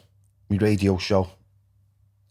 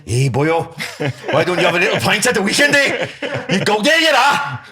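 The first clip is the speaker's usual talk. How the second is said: loud, high-pitched comic Welsh lilt; big Welsh accent; 7 semitones higher, 5 dB louder than usual. Acted. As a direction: ham up a booming, sing-song Welsh accent. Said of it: "in some big Welsh accent".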